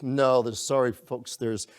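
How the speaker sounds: usual speaking voice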